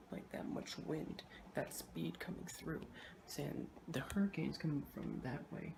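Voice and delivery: hushed talk indoors; quiet voice. Real, not acted. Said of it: "quiet indoor narration".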